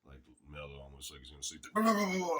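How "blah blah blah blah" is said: much higher, louder mock chatter; sudden burst of talk. Tired Rollins perking up mid-ride, loud high mock burst. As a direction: snap awake into a loud rant. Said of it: "he'd jump up and talk something again".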